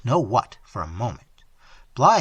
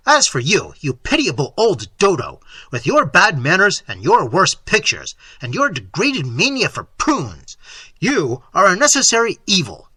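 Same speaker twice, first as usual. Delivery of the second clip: contemptuous; 4 semitones higher, 6 dB louder than usual. Acted. Insulting the old painter.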